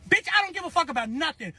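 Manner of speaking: fast, high-pitched retort; retold heated comeback; defiant anger. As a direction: snap back without a pause. Answers his phone call.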